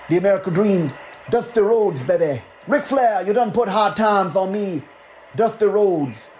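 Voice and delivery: high, drawling wrestler promo voice; Dusty Rhodes impression. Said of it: "I will be doing more bad impressions of wrestlers".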